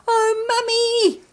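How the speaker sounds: high, slow wail; a scared child wailing for Mummy, high and drawn out. A narrator's reading. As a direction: wail for his mother, lost and alone. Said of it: "wailed John Joe".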